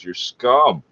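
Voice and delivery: ordinary talk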